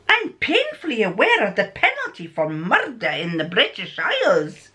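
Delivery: lilting, theatrical character voice with swooping pitch; anxious accused man. Acted. Man answering Holmes about the penalty for murder, theatrically protesting. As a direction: play the character with big rises and falls.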